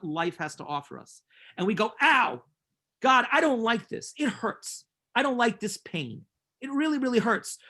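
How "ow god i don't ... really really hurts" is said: higher-pitched, hammed-up protest to God; mock pained complaint. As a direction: complain like a hurt child. Acts out people complaining to God.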